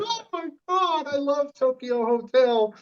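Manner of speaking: fangirl voice. Retold or imitated. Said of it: "I was like".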